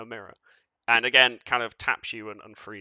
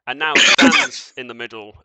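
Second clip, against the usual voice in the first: ordinary talk, nothing marked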